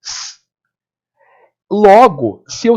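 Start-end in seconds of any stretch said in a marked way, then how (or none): 0.0-0.4 sustained soft "s" hiss; demonstrated hissing "s"; isolated s sound. Shown as a demonstration. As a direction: hold an unvoiced "s" alone. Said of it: "esse som de".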